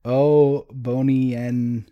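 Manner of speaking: sounding out French word by word; halting French attempt. Host attempting a French Guardian's line, halting French. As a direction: read unfamiliar French haltingly. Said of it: "Also, the Guardian was French".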